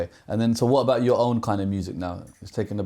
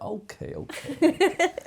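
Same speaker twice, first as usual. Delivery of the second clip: giddy excitement; slower than usual. Pretend. Imitating thrilled envelope opening.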